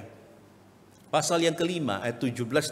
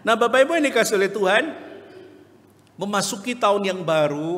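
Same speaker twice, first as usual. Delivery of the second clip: high, loud and fast address; rousing call; 7 semitones higher, 6 dB louder than usual. Real, not acted. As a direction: call the congregation to attention. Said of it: "preacher calls out". (memanggil jemaat dengan suara tinggi, keras dan cepat)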